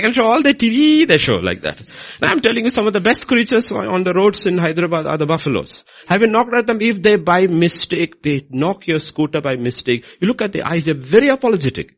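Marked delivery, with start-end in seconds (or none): none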